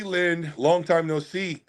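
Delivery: ordinary talk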